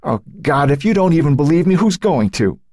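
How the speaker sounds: high, rushed, anguished plea; despairing cry, words tumbling out; distraught and close to tears; voice breaking. A narrator's reading. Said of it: "His voice broke".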